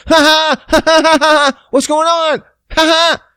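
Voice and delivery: very high, loud forced laughing; panicked cover-up. Retold or imitated. Man startled by door popping open, high panicked cover-up. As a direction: act caught out with loud, high fake cheer. Caught, fakes a loud cheery greeting.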